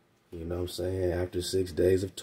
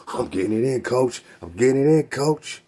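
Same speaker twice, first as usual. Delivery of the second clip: louder and louder, insisting to the coach; a fighter refusing to stop; eager boxer; 4 semitones higher, 9 dB louder than usual. Retold or imitated. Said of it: "You're like".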